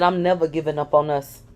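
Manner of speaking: ordinary delivery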